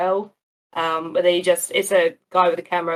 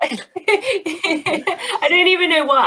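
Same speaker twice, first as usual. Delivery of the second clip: high voice breaking into slow laughter; giggly and high-pitched; laughing admission; 10 semitones higher, 4 dB louder, much slower than usual. Real, not acted. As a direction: laugh your way through the confession. Laughing at own TV habit.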